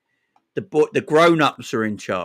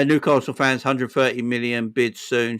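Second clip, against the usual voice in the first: ordinary talk, nothing marked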